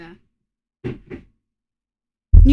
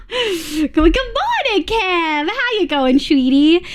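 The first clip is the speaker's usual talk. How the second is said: sweet greeting; 6 semitones higher, much slower than usual. Real, not acted.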